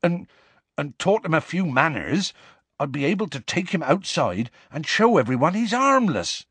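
high, faltering tearful plea; close to tears. A narrator's reading. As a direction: explain haltingly, near tears. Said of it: "sounding close to tears himself".

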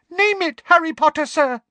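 very high, loud squeaky elf; beaming squeaky elf ready to help; eager house elf. Acted. Dobby beaming.

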